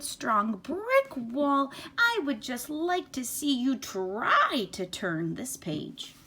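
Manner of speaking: high, excitable furry monster pleading and boasting; Grover voice. Acted. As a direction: play Grover, scared and then proud. Reads Grover's lines in character.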